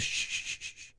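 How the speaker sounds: soft, repeated voiceless shushes; imitated shushing. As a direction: imitate a man hushing her, trailing away. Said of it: "the guy goes".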